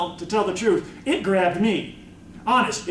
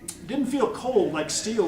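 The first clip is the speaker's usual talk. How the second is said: ordinary delivery, nothing marked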